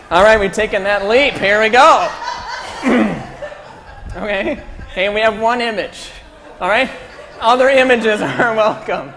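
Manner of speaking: real amused shout, pitched well up; laughing loudly. Real, not acted. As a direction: call out high and loud through laughter. Calls out over the room's laughter.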